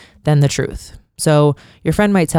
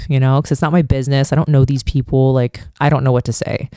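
normal talking voice

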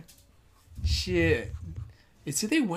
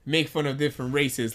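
normal talking voice

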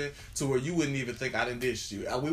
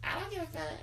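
put-on high, soft old granny voice; foul mouthed granny; 8 dB quieter than usual. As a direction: squeak it out like a cranky grandma. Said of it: "That was my grandma voice".

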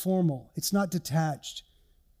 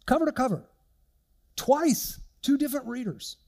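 amazed; 7 semitones higher than usual. Real, not acted. Amazed at son's task.

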